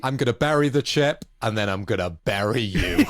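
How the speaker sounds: loud, hammed-up menace; play-acted threat, building for laughs; mock threat. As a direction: raise the voice like an over-the-top villain. Acts out character's comic threat.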